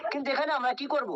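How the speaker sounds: normal talking voice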